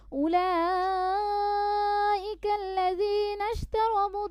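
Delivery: high, slow melodic recitation; Quran recitation. Shown as a demonstration. Teacher recites the passage for students to follow. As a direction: chant slowly and lift the long notes.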